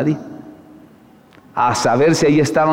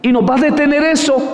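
much higher, fervent rebuke; preaching at full force; 11 semitones higher than usual. Real, not acted. Preacher rebukes a weak congregation in a fervent high cry. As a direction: call the flock out with fire.

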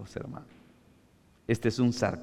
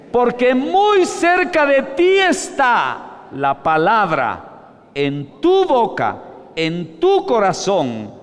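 much higher, loud and slow proclamation; shouted verse; 10 semitones higher, 8 dB louder, much slower than usual. Acted. Preacher calling the congregation to read aloud, shouting. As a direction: shout it out for all to hear.

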